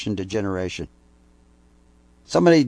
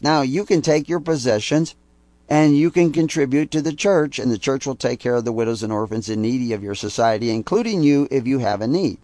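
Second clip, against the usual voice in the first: ordinary talk, nothing marked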